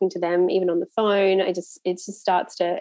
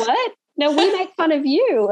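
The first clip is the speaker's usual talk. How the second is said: higher-pitched mock disbelief; mock indignation; 8 semitones higher than usual. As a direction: play up being taken aback, voice lifted. Mock outrage at Kiwi jokes.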